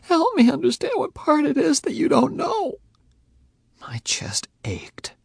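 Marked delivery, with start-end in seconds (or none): 0.0-2.7 voice cracking. A narrator's reading. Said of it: "her voice cracked".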